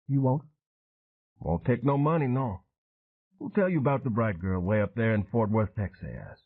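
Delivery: put-on matter-of-fact old sailor recalling the ambush; weathered Cajun veteran. Train telling Dan how his crew died, voiced.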